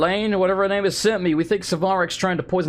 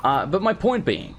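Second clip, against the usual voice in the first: ordinary talk, nothing marked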